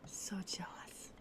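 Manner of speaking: breathy whispered confession, slow. Acted. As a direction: whisper it slowly like a secret. Mock confession.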